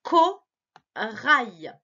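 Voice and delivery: slow reading aloud. Shown as a demonstration. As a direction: read Corail slowly for a child. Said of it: "essaie de lire avec moi".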